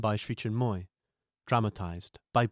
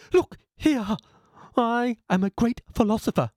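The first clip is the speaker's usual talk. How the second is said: higher, louder, puffed-up self-importance; haughty philosopher; 9 semitones higher, 4 dB louder than usual. Acted. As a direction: insist on your own greatness. Philosopher asserts himself.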